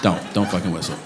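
low, soft, restrained warning; quiet warning. Real, not acted. Comedian cautioning the audience whistler, quietly stern. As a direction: drop right down and warn softly. Warns the whistler again after outburst.

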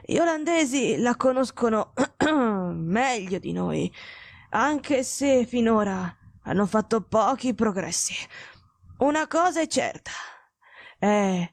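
slow, labored sickbed voice; ailing, imperious detective. Acted. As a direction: explain the illness with failing strength. (malato grave, parla lento e affannato)

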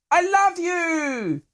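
very high, drawn-out mock call; heckler in crowd. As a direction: imitate an adoring fan calling to the stage. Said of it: "this guy went".

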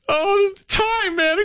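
high, far-off wistful sigh; teary sigh. Retold or imitated. Retelling tearing up at a commercial, high teary sigh. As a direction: sigh it out, overcome by how fast time goes. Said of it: "And I'm like".

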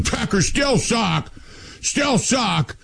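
drawn-out, high mock taunt of a drunk fan; slow sing-song heckle at the pub; drunk yelling. Drunk Bears fan yelling at the pub, slow high taunt. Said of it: "And he's just yelling".

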